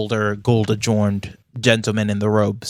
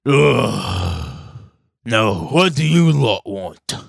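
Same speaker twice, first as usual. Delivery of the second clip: a grumbling, slow, bored royal voice; grumpy dwarf king; 3 dB louder, much slower than usual. Acted. Dwarf king greeting the party, slow and grumbling. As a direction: drawl it out like a fat king annoyed by visitors.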